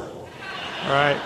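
breathy unvoiced "All right?"; whispered aside. Newcomer reassuring himself, a whispered aside. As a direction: whisper it to convince yourself.

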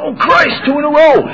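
high, mock-exasperated cry; high incredulous groan; exasperated disbelief. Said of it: "I said".

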